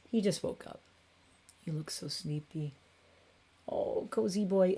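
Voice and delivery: hushed, tender coo; soft cooing. Real, not acted. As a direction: coo over the sleepy cat. Cooing over sleepy cat.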